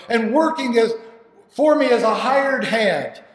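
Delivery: loud, excited storytelling; overjoyed. Acted. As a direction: tell it bursting with joy. Father runs to returning son.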